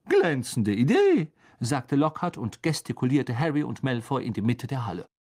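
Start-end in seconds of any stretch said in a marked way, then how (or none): 0.0-1.3 slow, higher, swooping enthusiasm; delighted approval. A narrator's reading. Teacher welcoming a colleague's suggestion, delighted. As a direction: stretch it out with showy delight.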